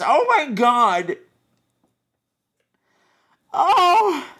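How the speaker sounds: high hammy tragic despair; melodrama. Acted. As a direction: wail like a soap heroine.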